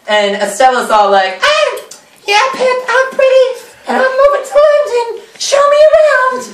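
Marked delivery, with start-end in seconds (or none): 1.4-6.4 put-on much higher, airy-girl voice; high Estella voice. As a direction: pitch right up like a vain young lady. Estella voiced in retold play.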